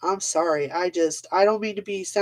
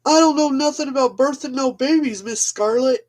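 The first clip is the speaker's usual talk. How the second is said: higher-pitched old Southern film accent imitation; mock old Southern accent; 6 semitones higher, 4 dB louder than usual.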